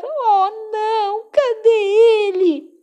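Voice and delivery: high worried voice; worried cry. Acted. Friends searching for Cabeçudo, high and worried. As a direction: call out anxiously for him.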